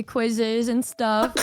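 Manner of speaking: ordinary delivery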